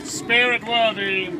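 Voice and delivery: slow, ominous haunted-house host; drawn-out spooky welcome to mortals; ghost host voice. Acted. Haunted Mansion ghost host impression.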